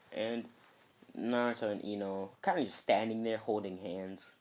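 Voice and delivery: plain reading voice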